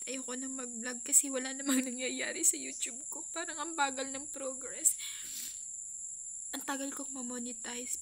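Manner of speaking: holding back sobs while talking; crying. Real, not acted. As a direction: voice breaking into tears. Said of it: "naiyak ako". (umiiyak, nanginginig ang boses)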